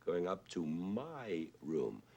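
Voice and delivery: "my" stretched out and swooping up in pitch; stress on MY. Shown as a demonstration. Lecturer showing the odd syllable, stress on "my". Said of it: "except for one syllable, which is my".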